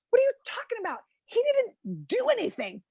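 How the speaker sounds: high-pitched indignant protest; mock outrage. Comic outrage at magazine praise.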